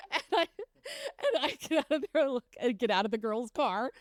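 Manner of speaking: talking through giggles; laugh-crying through the words; real laughter over the embarrassment. Real, not acted. Laughing while recounting the blunder.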